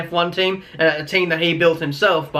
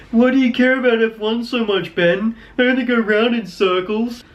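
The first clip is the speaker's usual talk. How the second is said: mocking non-fan; 6 semitones higher than usual. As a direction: sneer at F1 like someone who doesn't get it. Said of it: "when people are like".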